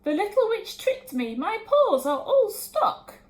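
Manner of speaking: high, loud squealing dragon; dragon crying out. A narrator's reading. Dragon stuck in the gloop, crying out high and loud. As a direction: wail in disgust.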